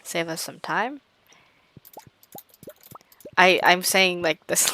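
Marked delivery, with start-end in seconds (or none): 3.4-4.7 rushed speedrun talk. Real, not acted. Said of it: "like I'm speedrunning this".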